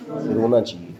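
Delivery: mock-pitiful teacher; mock sad. Shown as a demonstration. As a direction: sigh out रोना चाहिए as if about to cry, half-joking.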